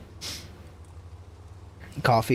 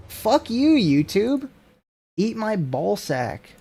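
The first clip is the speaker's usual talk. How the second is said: high-pitched angry outburst; 8 semitones higher, slower than usual. Real, not acted. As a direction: yell it up high in frustration. Angry at YouTube feature.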